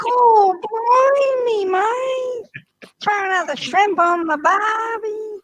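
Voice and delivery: slow, drawn-out mock Australian accent. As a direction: stretch out blimey like a cartoon Aussie. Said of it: "The kiwi sounds like an Aussie".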